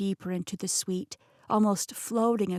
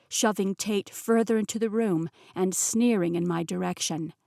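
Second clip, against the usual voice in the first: ordinary delivery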